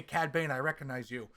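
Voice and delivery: usual speaking voice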